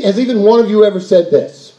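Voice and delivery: ordinary delivery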